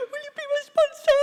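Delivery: very high hammed-up wail; mock tearful plea. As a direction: beg in an exaggerated crying squeal. Retold crying plea to woman.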